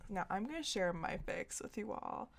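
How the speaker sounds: ordinary delivery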